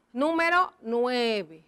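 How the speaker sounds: slow dictation. Shown as a demonstration. Dictating the address while writing.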